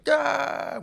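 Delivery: sudden high scream; startled scream. Retold or imitated. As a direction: shriek in fright. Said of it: "And I was like".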